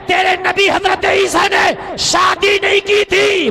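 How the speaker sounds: high, slow, drawn-out roar; shouted rebuttal. Acted. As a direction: thunder it out as the clinching blow. Climax of acted debate. (गरजकर, खींचते हुए)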